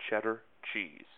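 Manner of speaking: mock Wisconsin accent, said softly and deadpan. Shown as a demonstration. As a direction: tack it on as token proof of a Wisconsin accent. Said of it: "say a type of cheese at the end".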